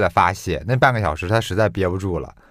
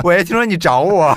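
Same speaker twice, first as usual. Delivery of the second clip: mock mother voice; 10 semitones higher, 6 dB louder than usual. Mock mother calling dad.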